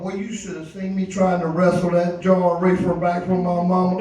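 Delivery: ordinary talk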